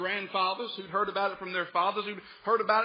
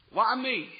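mock complaint; 3 semitones higher, 5 dB louder than usual. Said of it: "That's what Gideon's saying".

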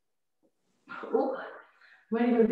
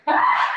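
straining shout; 8 dB louder than usual. Real, not acted. Straining mid-exercise.

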